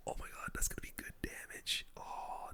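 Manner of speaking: usual speaking voice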